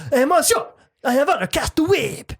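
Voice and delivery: high-pitched, staccato mock French; staccato French accent. Shown as a demonstration. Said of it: "a little more like staccato".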